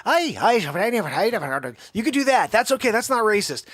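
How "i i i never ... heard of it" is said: quick burlesque Irish accent; mock Irish accent. Shown as a demonstration. Host showing an allowed accent, mock Irish accent. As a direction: toss off a jokey Irish accent. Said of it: "Irish is okay".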